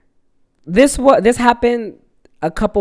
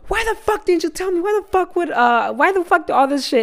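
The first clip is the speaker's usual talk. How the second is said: very high-pitched mock outrage; imitated mad mom; 13 semitones higher than usual. Mom scolds her child for not telling her, high mock-angry voice. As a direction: squawk indignantly as an angry mom. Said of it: "this bitch got mad at me".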